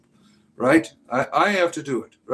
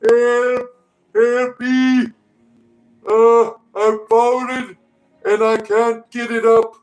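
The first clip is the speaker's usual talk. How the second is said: high squealing falsetto, pleading for rescue; mock Jesus crying for help; 8 semitones higher, 5 dB louder, slower than usual. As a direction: whine for help like a fallen old man, way up high. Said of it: "Jesus is saying".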